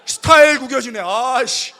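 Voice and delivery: fighting back tears with a loud curse; proud teen blurting out in loud frustration; embarrassed angry outburst. Retold or imitated. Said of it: "눈물이 나는데 난 자존심이 있잖아요".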